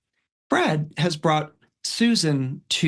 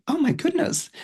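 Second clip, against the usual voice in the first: high, amazed gasp of a line; imitating a stunned mother; mock astonished mother, pitched up; taken aback; 13 semitones higher than usual. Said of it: "mother's kind of taken aback".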